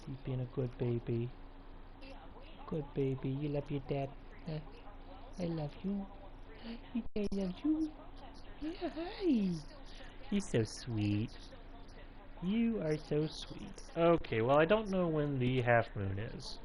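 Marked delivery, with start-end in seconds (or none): 0.1-4.6 soft, lightly cooing voice; mild pet talk. Real, not acted. Talking to his cat. 5.4-13.8 high, soft, slow cooing. Real, not acted. Talking to his cat.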